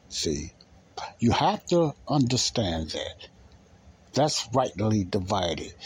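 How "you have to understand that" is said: ordinary delivery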